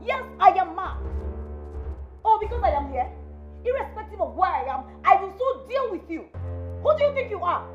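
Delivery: daughter-in-law snarling back in a fight; defiant rage. Acted. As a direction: throw madness back in her face. Sisters-in-law quarrel, defiant.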